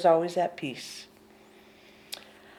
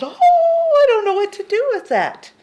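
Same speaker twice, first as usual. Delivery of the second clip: much higher, louder flustered voice; high loud mock bewilderment; baffled mind; 12 semitones higher, 10 dB louder than usual. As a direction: play the mind thrown off balance. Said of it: "The mind just goes".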